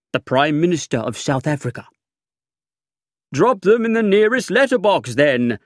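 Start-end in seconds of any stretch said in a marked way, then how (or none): 3.3-5.6 high, loud breezy retort; cheerful. A narrator's reading. Narrator breezily dismisses the official's secret documents. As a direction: toss it off cheerfully. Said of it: "I said cheerfully".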